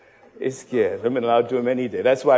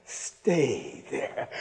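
ordinary talk

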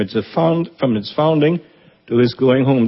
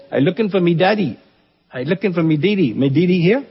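put-on a boy's Eleuthera island accent, higher and fast; 6 semitones higher, faster than usual. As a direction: mimic [name] doing a little boy's Eleuthera accent at the door, raised pitch. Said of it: "[name] went into that accent over there".

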